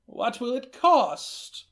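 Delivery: put-on high, slow old woman's voice; high, slow voice of an old grandmother. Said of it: "old Emmer Joe slowly nodded".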